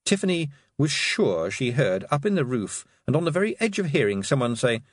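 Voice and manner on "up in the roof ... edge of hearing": ordinary delivery, nothing marked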